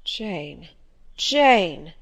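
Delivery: sorrowful calling of a name, low then swelling; bitter sadness. A narrator's reading. Rochester calls to Jane as she pulls away, bitterly sad. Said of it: "in such an accent of bitter sadness".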